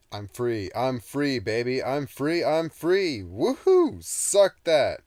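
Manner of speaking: very loud, high, climbing gloat; gleeful whoops. Acted. Freed demon gloating at Toby, ecstatic whooping. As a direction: crow your freedom to the rafters.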